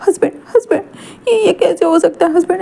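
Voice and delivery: high tearful pleading. Acted. Tearful Wing sees his husband hurt, high crying plea. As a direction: cry out in disbelief. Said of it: "wing आंखों में आंसू थे".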